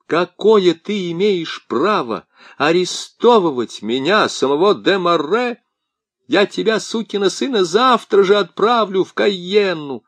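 high, shouted threat; furious threat. A narrator's reading. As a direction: threaten with Cayenne. Demaret threatens the officer.

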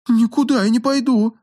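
scared, high-pitched refusal; backing away in fear; frightened. A narrator's reading. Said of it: "испуганно захлопала глазами та".

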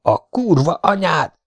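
furious curse. A narrator's reading. Keris the pilot curses as the helicopter is hit. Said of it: "üvöltötte Keris".

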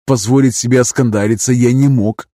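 ordinary delivery

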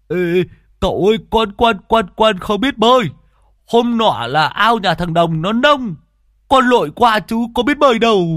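high, loud frantic begging; panicked pleading. Acted. Two men beg the young master not to send them down, high and loud. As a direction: stammer out the plea in a panic. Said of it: "thấy thế thì cũng rú lên".

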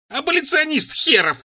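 high, drawn-out mocking echo; scornful retort. A narrator's reading. Цира seizes on the new topic, scornful. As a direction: throw the word back at a high pitch, stretched out. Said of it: "вцепилась Цира в новую тему".

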